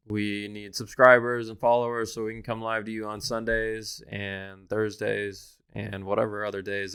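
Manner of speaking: normal talking voice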